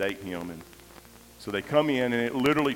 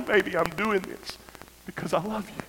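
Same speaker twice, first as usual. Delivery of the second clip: high and suddenly softer, pleading; tender, choked up; 7 semitones higher, 3 dB quieter than usual. Retold or imitated. Father to hurting son he loves, tender and tearful. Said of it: "I wanna tell him".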